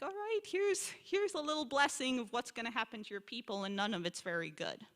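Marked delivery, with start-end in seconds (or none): 0.0-3.6 a high, offhand voice for Isaac giving a grudging leftover blessing; grudging father. Retold or imitated. Father Isaac grudgingly blesses his rejected son, high and offhand. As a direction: toss off the blessing half-heartedly, like scraps. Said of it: "It's this, like".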